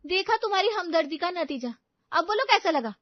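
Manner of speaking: high, loud told-you-so; gloating taunt. Acted. Mother taunting her son, gloating and high. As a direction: rub it in.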